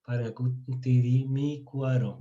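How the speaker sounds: parangacutirimicuaro slowly, in separated syllables; piece by piece. Shown as a demonstration. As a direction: sound out the whole long word bit by bit. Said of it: "se la graba por pedazos". (palabra larga dicha despacio, por pedazos)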